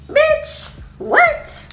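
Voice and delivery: very high, incredulous; jaw-drop disbelief; shocked. Retold or imitated. Hearing the friend snitched, shocked.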